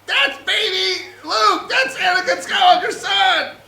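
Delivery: high, loud frantic scream. Acted. R2-D2 recognising baby Luke, frantic screaming. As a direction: scream the revelation, hammed-up panic. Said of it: "R two D two screaming".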